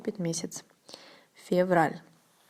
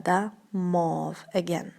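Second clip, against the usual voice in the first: ordinary talk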